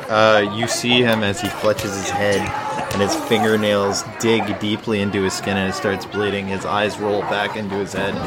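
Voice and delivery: ordinary delivery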